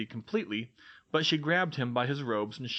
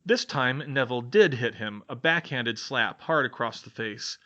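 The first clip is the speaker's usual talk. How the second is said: ordinary delivery, nothing marked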